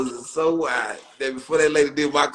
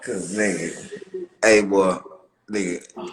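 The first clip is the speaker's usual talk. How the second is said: usual speaking voice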